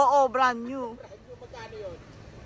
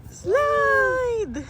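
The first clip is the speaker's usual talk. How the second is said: excited cheer; 10 semitones higher than usual. Real, not acted. Cheering kid down slide.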